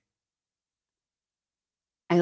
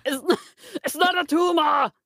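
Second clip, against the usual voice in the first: put-on higher, shouted "it's not a tumor" in an Austrian accent; Schwarzenegger impression; 8 semitones higher than usual. Quoting Arnold to her friend in a laughing shout. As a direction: bark it like Arnold. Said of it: "as Arnold Schwarzenegger".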